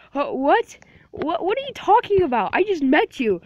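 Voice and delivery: high, loud startled what; mock shock at a stranger; surprised. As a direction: jump up in pitch, caught off guard. Surprised character voice.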